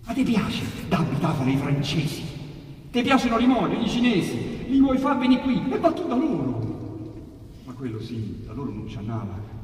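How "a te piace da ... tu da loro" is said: plain reading voice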